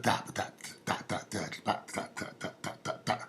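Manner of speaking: soft unvoiced da da scat; whispered da rhythm. Comic voicing the sixties rhythm, in whispered da scat. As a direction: breathe the beat in das. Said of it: "Got a rhythm".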